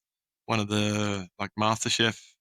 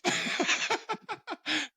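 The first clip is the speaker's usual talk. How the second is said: squealing laugh that shoots up high; high burst of laughter; 5 dB quieter than usual. Real, not acted. Telling a friend about a bad client, laughing high.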